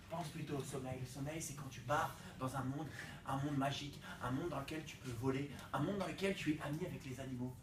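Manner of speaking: tender dream voice; calm and dreamy. Acted. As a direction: lull like a bedtime tale. Calms himself, dreamy sleep world.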